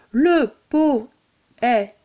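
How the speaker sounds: poète chopped into "po - è - teu"; le, po, è, teu, with gaps between; syllable by syllable. Shown as a demonstration. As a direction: scan le poète one beat at a time, final E sounded. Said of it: "puisque le E là aussi est suivi d'une consonne".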